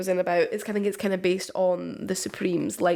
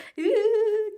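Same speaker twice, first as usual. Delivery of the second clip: high laugh; 14 semitones higher than usual. Real, not acted. Laughing at her own crying.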